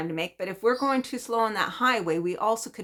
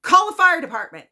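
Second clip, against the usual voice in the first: higher and louder urgent command; husband telling the neighbor to call for help, higher and louder; urgent order; 10 semitones higher, 11 dB louder than usual. Retold or imitated. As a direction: bark out the order to get help. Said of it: "And John's like".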